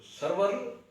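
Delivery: सरवर drawn out slowly as sar-war; careful pronunciation. Shown as a demonstration. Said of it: "सरवर के सीन पर ज़बर है". (सरवर धीरे और साफ़, सीन पर ज़बर)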